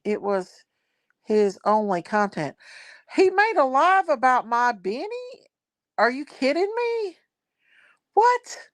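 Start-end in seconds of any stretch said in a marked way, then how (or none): 3.1-8.6 rising, much higher, incredulous; can't believe what she's hearing, voice shooting up; shocked disbelief. Real, not acted. Streamer hearing someone mocked her Benny, shocked and rising.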